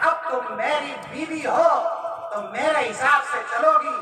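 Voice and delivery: loud, high-pitched angry orders; domineering shout. Acted. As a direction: lay down the law at full volume. Husband orders wife about.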